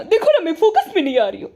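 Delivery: quick, lightly put-on coy voice; a hint of a pouting influencer fussing over focus; teasing imitation of a posing wife, rushed; mocking the madam.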